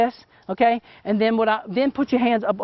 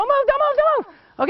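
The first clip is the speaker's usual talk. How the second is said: urgent excited freeze call; 14 semitones higher than usual. Real, not acted. Model falls into a pose.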